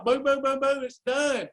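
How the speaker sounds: normal talking voice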